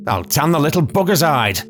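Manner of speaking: high, indignant ranting; furious old lady threatening a young man; spoiling for a fight. A narrator's reading. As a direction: bristle for a fight, pitch climbing. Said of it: "spoiling for a fight".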